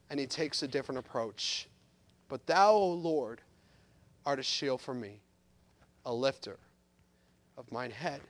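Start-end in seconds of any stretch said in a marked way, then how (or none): 2.3-8.2 "But thou, O Lord" much softer and lower, with long pauses; hushed reverent reading. Shown as a demonstration. Preacher reading the psalm to the congregation in a hush. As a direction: breathe the verse out low and reverent. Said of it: "there's a change".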